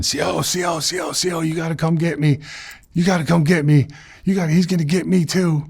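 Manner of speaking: high, pleading shout for the guard; retold panicked scream. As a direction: mimic a man yelling for help in terror. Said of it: "I hear the other guy screaming".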